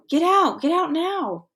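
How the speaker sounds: high frantic yell of warning; panicked shouted warning; screaming. Acted. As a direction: scream it at them to get out. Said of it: "he screamed".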